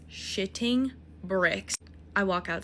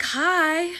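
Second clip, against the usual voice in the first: higher, louder mock greeting; mock guy hello; 9 semitones higher, 5 dB louder than usual. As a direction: squeak out his hi. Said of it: "He's like".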